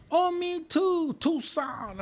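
high excited mock voice. Bartender answering excitedly.

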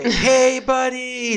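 high, loud friendly hello to a dog; cheery greeting. Retold or imitated. Greeting the neighbor's dog on the street, high and cheery. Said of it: "Yo le llegué y que".

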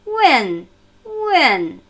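"Wen" loud, falling steeply from the top; falling fourth tone. Shown as a demonstration. Teacher has learners listen to the tone, "Wen" sharply falling. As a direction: hit "Wen" firmly and let it drop. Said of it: "Fallender vierter Ton".

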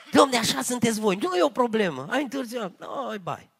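mock careless youth. Mocking the youth's easygoing excuse.

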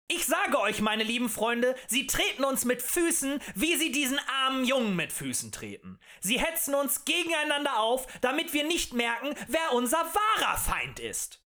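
high, loud orator's pitch; impassioned tavern agitator; rabble-rousing harangue. A narrator's reading. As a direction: stir up a crowd with indignation.

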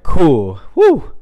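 high, loud, gleeful cheer of someone stoned; excited whoop. Retold or imitated. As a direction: whoop it out like you're thrilled to be high. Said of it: "And I'm like".

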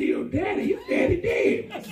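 high shout, slowing and laughing; incredulous. Retold or imitated. Husband retorts to wife about her dead father, incredulous. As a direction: exclaim it, half laughing.

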